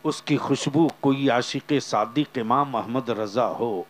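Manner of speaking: ordinary delivery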